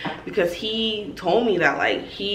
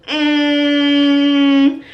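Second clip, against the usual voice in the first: long, loud mock "mm"; drawn-out hum of hesitation; doubtful hum; 7 semitones higher, 9 dB louder than usual. Acting out his doubtful reaction.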